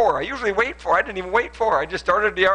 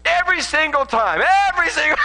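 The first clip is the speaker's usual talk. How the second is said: much higher and louder, exasperated yell; hammed-up flip-out at the door; mock outburst; 13 semitones higher, 8 dB louder than usual. Husband flipping out at his innocent wife, hammed-up loud yell. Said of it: "I was like".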